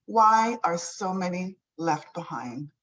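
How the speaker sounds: normal talking voice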